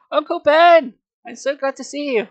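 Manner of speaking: high, loud glad exclamation; exclaiming. Acted. Boy hugging his uncle, high glad exclamation. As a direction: burst out with joy. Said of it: "I exclaimed".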